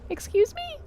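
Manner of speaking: a sudden, very high squeak of mock offence; shocked excuse me. Real, not acted. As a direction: pretend to be scandalised by chat. Reacting to a chat message.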